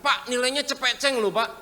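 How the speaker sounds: high, loud boastful offer; hammed-up proud churchgoer; showy giver. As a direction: brag that it's a big note.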